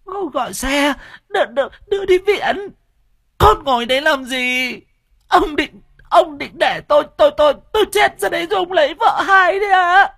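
very high, loud shrieking abuse; screaming curses. Acted. Wife in labor screaming at her husband, loud high shrieks. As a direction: shriek at him, half sobbing. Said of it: "Mụ bắt đầu ngoạc mồm ra mà chửi".

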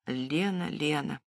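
quietly, to himself; soft murmur. Acted. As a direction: murmur the name softly, lost in thought. Igor muses on his wife's name.

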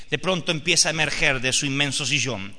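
usual speaking voice

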